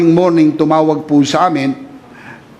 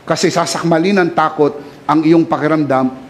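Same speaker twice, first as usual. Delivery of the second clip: ordinary talk, nothing marked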